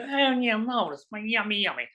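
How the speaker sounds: high, plummy diners' voice; lightly voiced posh diner. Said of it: "people would be eating it".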